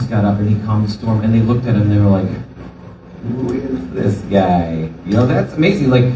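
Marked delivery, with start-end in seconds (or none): 3.2-4.9 slow, hushed amazement; stunned, quiet who is this; slow awe. Retold or imitated. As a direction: let the wonder stretch out each word. Awed disciples after the calm.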